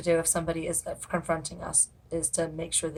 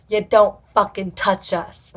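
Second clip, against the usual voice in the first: slow, loud, furious; slow angry yell, each word hit; angry shout; 3 semitones higher, 11 dB louder, slower than usual. Retold or imitated. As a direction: bark it at the creep who touched you. Retelling calling out a groper.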